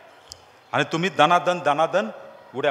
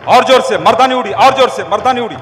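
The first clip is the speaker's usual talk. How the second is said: loud, higher shouted chant; shouted cheering; 5 semitones higher, 9 dB louder than usual. Real, not acted. Speaker urging the jumping crowd to go harder, loud high shout. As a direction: roar them on like a drill leader.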